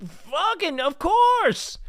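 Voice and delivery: very high, rising to loud "of course!"; mock eager agreement. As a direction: agree excitedly, building. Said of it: "and she was like".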